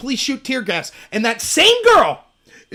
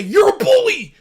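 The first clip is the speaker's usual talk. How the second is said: high, louder whiny accusation; mock accusation; 8 semitones higher, 6 dB louder than usual. Mocking critics crying foul, high whiny voice. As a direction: whine it like a victim. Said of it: "they say".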